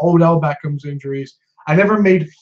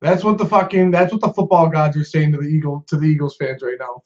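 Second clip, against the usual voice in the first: usual speaking voice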